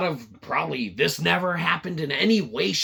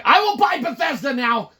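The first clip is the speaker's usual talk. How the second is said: grand boss voice; 8 semitones higher, 6 dB louder than usual. Retold or imitated. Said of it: "He's like".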